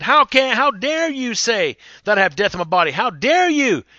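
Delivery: indignant patient. Acted. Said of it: "if that guy got upset".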